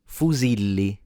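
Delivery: fusilli, fu-ZI-li with a Z sound; Italian fusilli. Shown as a demonstration. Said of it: "correct pronunciation of the word".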